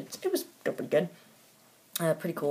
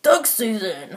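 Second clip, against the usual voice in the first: exaggerated hero impression, higher; Green Arrow; 4 semitones higher, 4 dB louder, slower than usual. Reviewer repeating a hero's catchphrase to mock it, silly impression. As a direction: punch it out in a silly put-on voice. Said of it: "that was his voice".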